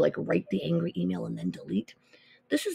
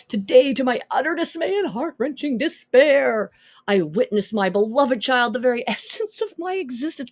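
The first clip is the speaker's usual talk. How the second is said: melodramatic reading; 5 semitones higher, 5 dB louder than usual. Pretend. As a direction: read it like an overwrought soap-opera letter. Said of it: "This is, like, more dramatic".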